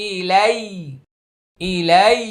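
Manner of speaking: "ইলাই" held on its ending; held pronunciation. Shown as a demonstration. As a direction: linger on the final glide of "ইলাই". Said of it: "একটু ধরে রাখবেন উচ্চারণটা". ("ইলাই" শেষে একটু ধরে রেখে)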